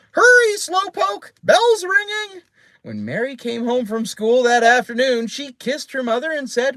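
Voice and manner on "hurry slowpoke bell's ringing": high, loud parrot yell; screeching, nagging parrot; Penelope. A narrator's reading. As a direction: yell after someone in a shrieking bird voice. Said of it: "Penelope yelled after her".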